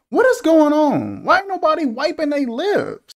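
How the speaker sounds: high-pitched exasperated questions; incredulous outcry. Real, not acted. Critic appalled at unwiped lips, incredulous. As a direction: exclaim in squeaky disbelief.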